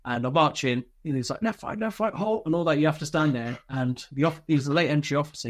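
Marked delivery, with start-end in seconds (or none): 1.4-2.4 drill command. Retold or imitated. Officer halting him as he marches in, mock drill command. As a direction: imitate a drill order without shouting.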